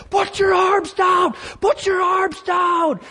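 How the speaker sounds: very high, strained, drawn-out mock shout; yelling coach. Coach to a celebrating runner mid-race, yelling. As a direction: imitate a coach yelling from far across the track. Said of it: "He's yelling at me".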